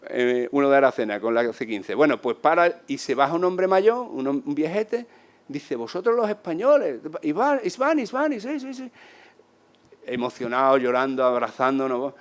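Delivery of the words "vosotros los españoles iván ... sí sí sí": put-on high, eager old man's voice; excited old man. Old man greeting the Spanish riders, thrilled.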